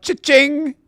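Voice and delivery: high, loud cha-ching; imitated cash register. Host celebrates a five dollar super chat, imitated cash register. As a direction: ring it up like a till, pitched high. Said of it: "That's what I need is the cha-ching".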